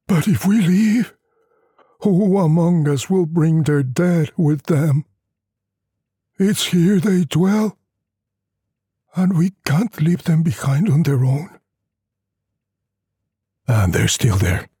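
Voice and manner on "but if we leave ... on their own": higher, lightly voiced weary villager, wry; old peasant doubting the schoolmaster, a bit higher; Luvina villagers. Villagers refuse to leave their dead.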